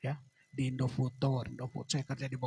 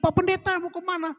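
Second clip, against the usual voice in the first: high-pitched raised-voice call to someone passing; shouting call; 13 semitones higher, 4 dB louder than usual. Retold or imitated. Neighbour hailing the pastor as he passes by, shouting. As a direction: imitate a neighbour yelling out, pitched way up. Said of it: "dia teriak".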